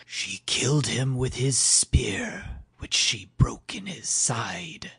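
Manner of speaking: slow, high ghostly whisper; eerie whisper. Acted. Hel's messenger telling how Odin died, slow whisper. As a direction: breathe the words like a wraith.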